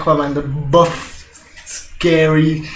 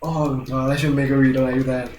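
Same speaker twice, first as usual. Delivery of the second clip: usual speaking voice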